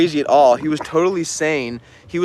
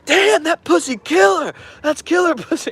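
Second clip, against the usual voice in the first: awed dude; 4 dB louder than usual. Retold or imitated. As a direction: marvel at it, stretching the words. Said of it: "he's like".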